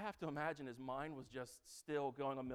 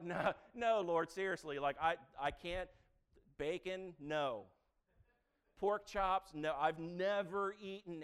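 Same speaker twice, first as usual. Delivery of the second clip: loud, high, exasperated pleading; Peter pleading; 5 semitones higher, 8 dB louder than usual. Retold or imitated. Peter pleads loudly with God over bacon and pork chops. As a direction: beg God to let him off. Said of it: "Peter had to be sitting there like".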